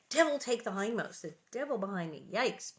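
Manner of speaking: ordinary delivery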